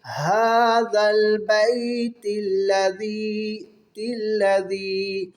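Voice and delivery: slow reciter's chant, words linked; ملا کر تلاوت; joined recitation. Shown as a demonstration. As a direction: flow هَذَا الْبَيْتِ الَّذِي together, drawn out. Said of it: "ملا کے پڑھنا".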